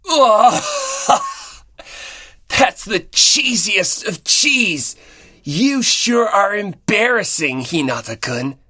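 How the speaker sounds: cracking up, slow and mocking through the laugh; laughing teasing. Komaeda mocking Hinata's cheesy plan, laughing hard. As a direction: laugh at him while you tease. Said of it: "doubling over in laughter".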